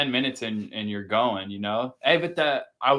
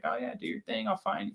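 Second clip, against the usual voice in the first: casual killer taunt; 9 semitones higher, 10 dB quieter than usual. Retold or imitated. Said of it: "and he's like".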